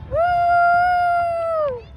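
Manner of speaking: long, high whoop; cheering woo. Real, not acted. Cheering the Canada Day fireworks, high whoop. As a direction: give a high, drawn-out woo.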